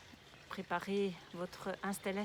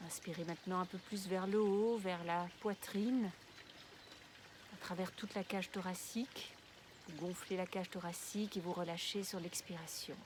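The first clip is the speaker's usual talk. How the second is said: guided meditation; 6 dB quieter than usual. Real, not acted. Guide une relaxation de yoga nidra. (voix douce et posée de méditation guidée)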